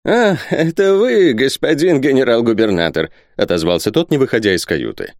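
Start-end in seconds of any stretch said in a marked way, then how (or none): 0.1-3.1 put-on old miser. A narrator's reading. Isaac answering the governor from his cabin, high-voiced.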